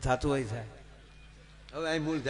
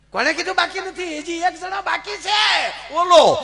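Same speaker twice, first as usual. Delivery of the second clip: much higher and louder, a thundering goddess bellow; divine roaring shout; 14 semitones higher, 8 dB louder than usual. Acted. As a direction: boom it out like an angry goddess appearing. Goddess takes form, roars.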